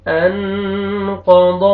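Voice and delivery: انقض with the نون hidden through the nose; nasal ikhfa. Shown as a demonstration. Teacher models nasal ikhfa in انقض. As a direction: take the sound of انقض into the nose before the heavy قاف. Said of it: "اخفا کرنا ہے، نوز میں sound".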